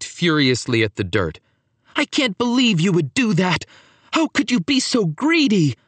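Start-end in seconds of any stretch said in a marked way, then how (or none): 1.9-5.8 high, indignant outburst; outraged. A narrator's reading. Paolo kicking dirt, scolding his cousin, outraged. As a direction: accuse him in high-pitched fury.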